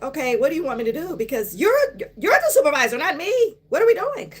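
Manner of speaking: pushing the clueless supervisor to lead, voice climbing; exasperated questioning. Retold or imitated. Telling the supervisor she's in charge, climbing exasperated. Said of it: "And I'm like".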